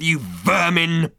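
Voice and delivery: officer spitting at prisoners; drawn-out sneer. Acted. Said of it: "he growled".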